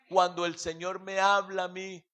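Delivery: usual speaking voice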